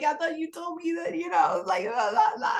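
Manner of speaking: half-joking high-pitched grievance; mock tantrum at God. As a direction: rail upward in a hammed-up protest. Acted plea outside to God.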